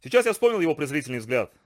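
ordinary delivery

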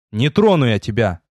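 ordinary delivery, nothing marked

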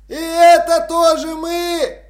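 high, slow mock shout from the house; shouted from inside. A narrator's reading. Hidden robber calling out to the watch, a high mock shout. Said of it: "прокричал кто-то".

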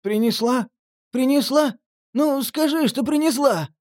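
high, desperate begging; eager pleading. Acted. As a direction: beg for the fix. Junkie begs for glue.

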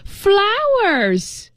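put-on high shrill scream of an old woman. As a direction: cry out the word in delight. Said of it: "screamed the old woman".

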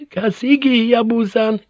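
put-on high, frail old woman's voice; frail old lady. A narrator's reading. Injured old lady addresses Yabu, high frail voice. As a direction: call him weakly, as an old noblewoman. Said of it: "her voice hoarse and frail".

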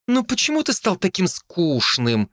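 high, whiny indignant complaint; indignant sister. A narrator's reading. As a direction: protest like a bored little sister. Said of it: "возмутилась Настя".

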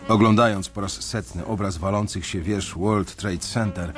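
normal talking voice

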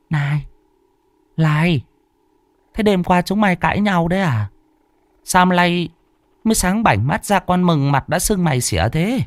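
put-on high, fussing old woman's voice; pry with worry like a mother; old mother nagging. A narrator's reading. Mother questions son. (giọng bà mẹ cao, càu nhàu)

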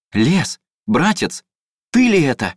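high, loud happy call; joyful greeting. A narrator's reading. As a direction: hail your long-lost brother.

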